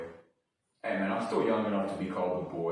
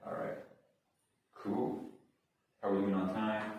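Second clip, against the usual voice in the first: ordinary talk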